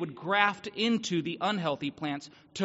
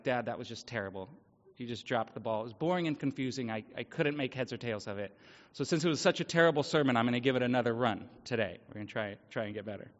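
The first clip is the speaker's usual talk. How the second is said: quick, hushed mock of a kid's critique; teasing son; 7 semitones lower, 4 dB quieter, faster than usual. Son mocking his dad's sermon, quick and hushed. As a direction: rattle it off softly like a cheeky son.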